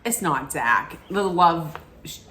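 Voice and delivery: ordinary talk, nothing marked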